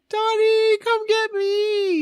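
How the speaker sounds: high, slow pleading call; lovesick plea. Retold or imitated. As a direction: cry out to him, way up high. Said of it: "And I would say".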